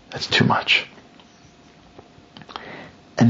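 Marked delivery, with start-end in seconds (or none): 0.1-0.9 whispered disgust. Acted. King, after outburst.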